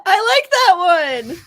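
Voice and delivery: high, loud laughing delivery. Real, not acted. Laughing at the joke.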